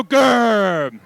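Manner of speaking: drawn-out growled grr; tiger growl. Shown as a demonstration. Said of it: "do your best grr".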